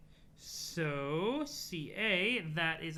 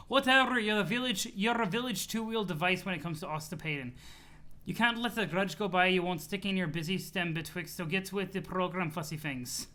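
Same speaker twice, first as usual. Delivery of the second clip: CA. Acted. CA telling off the auspistice, huffy accented voice. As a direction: scold in a silly put-on accent. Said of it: "laughing at the C A voice".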